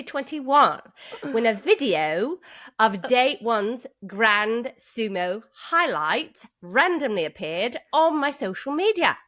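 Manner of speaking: labored, bad Swindon accent; British accent. Host reading English winner's letter, put-on Swindon accent. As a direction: read it out in an exaggerated amateur British accent. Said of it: "I'll do the accent for just a sentence or two".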